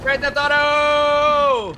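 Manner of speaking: loud drawn-out cheer; excited shout. Real, not acted. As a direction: yell it out slowly with glee. Gleeful yell on drifting boat.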